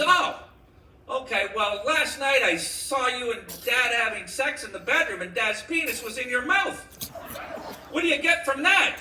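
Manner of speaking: high, loud little daughter; puzzled child piping up, voice pitched high; little girl. Acted. Daughter questions mother about last night, little girl. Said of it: "she was a little girl".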